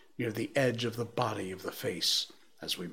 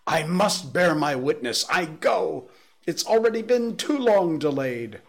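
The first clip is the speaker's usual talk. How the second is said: high, much louder defiant shout; 7 semitones higher, 8 dB louder than usual. A narrator's reading. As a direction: roar it at the top of the lungs. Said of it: "shouted the curate at the top of his voice".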